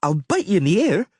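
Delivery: put-on a child shouting a threat; girl yelling. Ciri threatening to bite the Witcher, high yell. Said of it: "she yelled".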